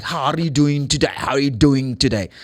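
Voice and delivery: thick imitated Scottish accent; strong Scottish accent. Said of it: "really strong pronunciation, accent".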